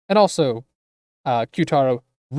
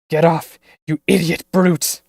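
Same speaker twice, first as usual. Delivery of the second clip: angry shout; 2 semitones higher, 6 dB louder than usual. Acted. Struggling against the attacker.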